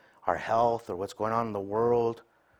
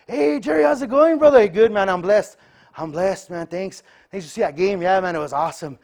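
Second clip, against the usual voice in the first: much higher, louder hearty hello; fake cheerful greeting; 10 semitones higher, 13 dB louder than usual. Brothers greeting at church, hearty mock cheer. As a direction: put on a blessed front, loud and bright.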